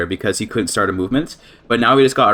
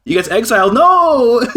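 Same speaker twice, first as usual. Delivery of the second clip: shrill cry of no, much higher and louder; dismayed outcry; 13 semitones higher, 6 dB louder, slower than usual. Real, not acted. Player cries out as the new king gets exiled, dismayed shout. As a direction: wail no as the prize slips away.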